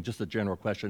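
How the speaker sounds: ordinary talk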